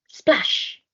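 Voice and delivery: high splashy effect word; splash sound. A narrator's reading. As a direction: make it sound like water splashing.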